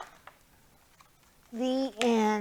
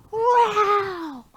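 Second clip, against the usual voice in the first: high playful growl; tiger growl; 10 semitones higher, 5 dB louder than usual. Acted. Teacher growling along in the song, playful growl. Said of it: "you get to growl".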